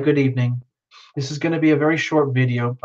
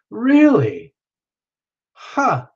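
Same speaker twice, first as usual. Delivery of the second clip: surprised; 8 semitones higher, 3 dB louder, much slower than usual. Real, not acted. Reacting to part Indian news.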